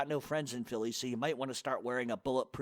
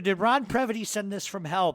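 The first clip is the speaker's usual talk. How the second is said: usual speaking voice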